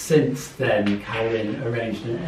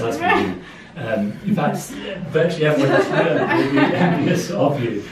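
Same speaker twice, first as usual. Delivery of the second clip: laughing; 3 semitones higher, 7 dB louder than usual. Real, not acted. Congratulating, laughing while speaking.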